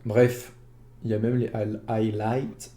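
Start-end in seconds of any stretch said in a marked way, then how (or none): 1.5-2.7 "highlights" said in English with a French accent. Real, not acted. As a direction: say "highlights" the way a French speaker would. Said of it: "désolé pour mon accent". ("highlights" prononcé avec l'accent français)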